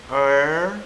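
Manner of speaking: "er" drawn out, no roll on the R; untrilled R. Shown as a demonstration. Teacher repeating Mandarin R, untrilled "er". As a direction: hold "er" without any trill. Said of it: "R-nya tidak digetar".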